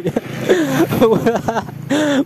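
high, bright burst of laughter at the memory; real laughter. Real, not acted. Laughing at own story.

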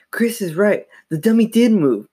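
high-pitched, earnest insistence; emphatic insistence. A narrator's reading. Sister defending Chris to their parents, insistent. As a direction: insist firmly on the truth.

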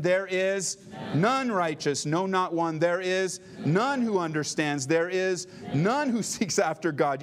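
none drawn out slow and leaned on; stress on none. Shown as a demonstration. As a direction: hit "none" each time, slowly. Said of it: "emphasize the word none".

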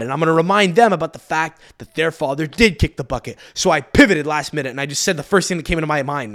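normal talking voice

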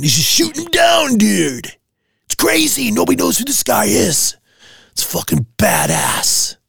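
high, hyped-up teen voice imitating his son; excited son. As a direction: ham up a starstruck kid raving about a hero. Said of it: "Even my son was like".

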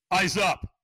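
loud, high shouted command; retold trainer's command. Trainer orders him to look up, shouted command. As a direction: imitate a trainer calling out sharply.

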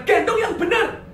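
high-pitched exasperated telling-off; acted scolding. Husband scolding wife for holding the baby wrong, acted scolding. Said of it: "ku marahin".

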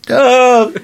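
loud high call across distance; yelling out. Acted. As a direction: shout the name after him. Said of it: "I was yelling out to you".

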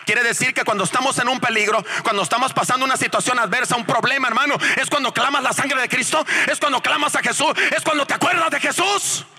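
high preaching voice, climbing to a cry; fervent preaching. Real, not acted. Preacher urges brothers to call on Jesus, fervent preaching.

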